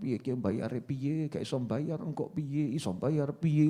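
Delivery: imitating a worried debtor, low and quiet; mock-fretting under the breath; fretful muttering. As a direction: mutter anxiously to yourself, slowly. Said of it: "Orang mikir nyawang".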